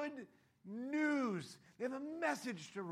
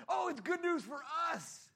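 pleased Sadducees; 6 semitones higher, 4 dB louder than usual. Retold or imitated. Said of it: "the Sadducees would go".

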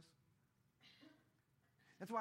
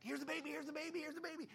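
excited shepherds; 11 semitones higher, 4 dB louder, faster than usual. Acted.

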